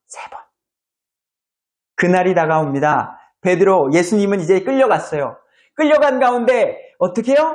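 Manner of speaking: ordinary talk, nothing marked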